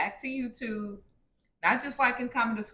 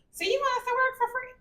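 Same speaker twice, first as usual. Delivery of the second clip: put-on incredulous kid; faster than usual. Kid voiced in retold scene.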